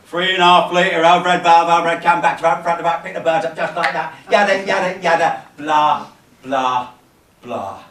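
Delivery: loud run-on banter that fades to blah; mocking chatter. Lone newcomer mimics the lads' tea-room talk, mocking. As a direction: rattle it off, then let it die away.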